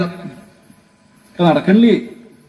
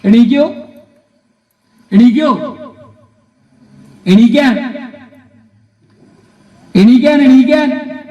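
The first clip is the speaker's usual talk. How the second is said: calling out; 4 semitones higher, 4 dB louder, slower than usual. Acted. Calling receivers to get up.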